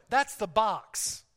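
slowed right down, word by word; slow, deliberate. Shown as a demonstration. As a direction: lay out each word slowly. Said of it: "You can say it this way".

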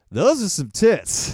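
laughing hammed-up line; laughing mock exclamation. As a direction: crack up while saying it.

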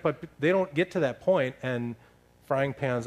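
ordinary delivery

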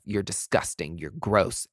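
usual speaking voice

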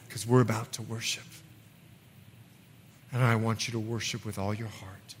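soft, low call to worship; quiet altar call over music; worship invitation. Acted. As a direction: gently draw them into worship.

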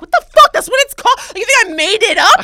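very loud, high indignant yelling; outraged outburst. Retold or imitated. Woman blows up at stranger over salad name, shouted outrage. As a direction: blow up without thinking, far too big. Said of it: "without, like, thinking, I was like".

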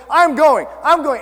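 retold panic. Recalls panic on sliding ladder.

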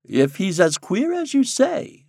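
plain reading voice

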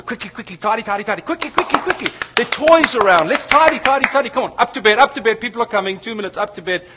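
high, loud, urgent rush of orders; hurried parent. Retold or imitated. Parent rushing kids to bed before guests come, hurried and high.

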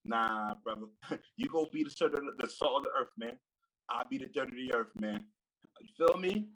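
normal talking voice

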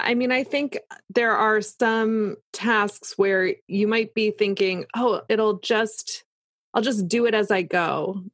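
normal talking voice

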